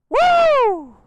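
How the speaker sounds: a loud, high whoop of thrill; excited whoop. Real, not acted. Rider whooping at viewers after a hard rev, loud high whoop. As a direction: let out a real whoop as the bike pulls.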